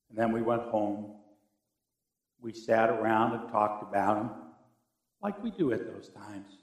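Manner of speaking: low, subdued telling; hushed and grave, pitch dropped; somber recollection. Real, not acted. Recalls day his father died.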